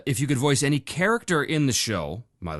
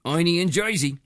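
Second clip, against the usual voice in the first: hammed-up Jersey accent; playful New Jersey twang; 7 semitones higher, 3 dB louder than usual. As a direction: say it like a thick Jersey local. Repeats place name in local accent.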